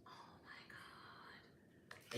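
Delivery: slow breathless whisper; awed whisper. Real, not acted. Vlogger to viewers, whispering in wonder at the art.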